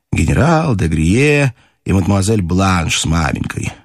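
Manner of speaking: usual speaking voice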